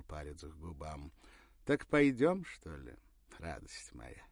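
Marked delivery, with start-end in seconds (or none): 1.6-4.2 warm. Acted. Porfiry's real voice returns.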